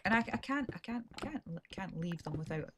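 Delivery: normal talking voice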